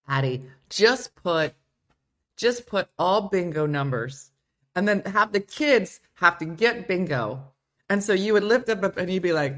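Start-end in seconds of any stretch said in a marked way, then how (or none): none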